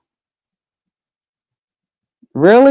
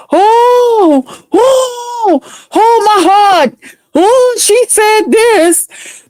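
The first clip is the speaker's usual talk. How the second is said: high, wailing fake sob voice, slow and pitched way up; hammed-up crybaby whimper mocking the rival; mock crying; 11 semitones higher, much slower than usual. Mocking a rival who complains online, a high fake-crying wail. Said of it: "now it's".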